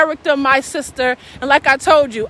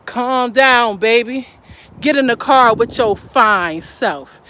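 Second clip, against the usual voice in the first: smooth sweet talk; 4 semitones lower than usual. Retold or imitated. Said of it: "He said".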